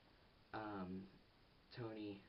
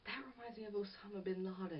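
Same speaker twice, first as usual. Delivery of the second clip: lightly mocking high girlfriend voice, pitched up; girlfriend's naive line, mock-voiced higher; girlfriend quote; 10 semitones higher than usual. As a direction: imitate her innocent remark in a high mock voice. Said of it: "she's like".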